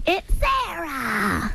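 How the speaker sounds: high, very slow drawn-out put-on girl voice; mimicking a comedian's annoying voice, high and slow; Sarah Silverman impression. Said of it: "You can actually do a Sarah impression".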